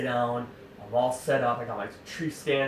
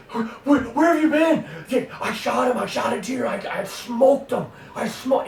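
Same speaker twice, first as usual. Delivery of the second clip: frantic excitement; 11 semitones higher, 6 dB louder than usual. Retold or imitated. Frantic Matt in the tree stand.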